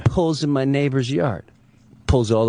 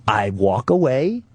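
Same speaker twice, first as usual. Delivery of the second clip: normal talking voice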